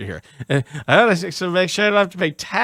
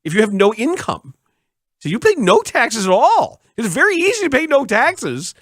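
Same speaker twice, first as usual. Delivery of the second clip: much higher, sing-song smug accountant; mocking accountant; 9 semitones higher than usual. Host imitating his accountant's tax advice, high and smug. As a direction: ham up the know-it-all advice. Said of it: "He says".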